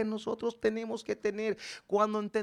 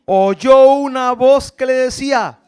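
loud, rising narration; booming build-up; 7 semitones higher, 18 dB louder than usual. Acted. Preacher booming to the congregation. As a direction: swell toward the heavenly voice.

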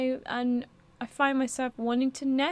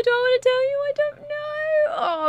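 high, squealing tease; sing-song coy dithering; playful teasing; 6 dB louder than usual. Real, not acted. Teasing the viewers with a secret.

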